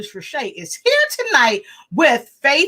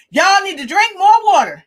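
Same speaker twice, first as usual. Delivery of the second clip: high, loud quoted order; imitated emphatic doctor; 9 semitones higher, 7 dB louder than usual. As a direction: proclaim it like the doctor laying down advice. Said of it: "He said it just like that".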